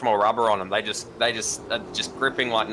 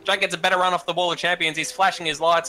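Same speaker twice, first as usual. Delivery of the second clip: motorsport commentator; 6 semitones higher than usual. Real, not acted. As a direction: lively race call, a bit higher. Live play-by-play.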